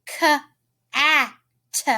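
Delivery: "K-A-T" as kuh, aah, tuh; sounded out. Shown as a demonstration. Teacher testing children's ears, "K-A-T" sounded kuh, aah, tuh. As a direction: separate "K-A-T" into three letter sounds with gaps. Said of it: "Do you hear an I sound?".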